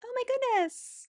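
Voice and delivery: fake perky wake-up. Mocking an influencer waking on camera, hammed-up cheerful gasp. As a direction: ham up an influencer's sunny wake-up. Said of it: "alarm goes off".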